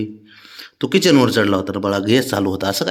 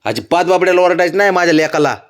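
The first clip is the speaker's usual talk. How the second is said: higher, quick pretend telling-off; mock scolding; 7 semitones higher, 4 dB louder, faster than usual. Father pretends to scold mother for the sulking child, mock scolding. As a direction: scold in play on the child's behalf. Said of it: "तुझ्या समोर ओरडतो".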